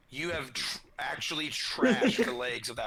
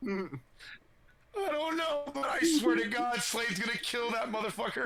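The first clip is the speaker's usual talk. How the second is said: shocked soldier voice; 9 semitones higher than usual. Acted.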